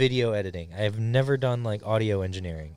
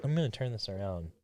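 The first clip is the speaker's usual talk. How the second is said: ordinary delivery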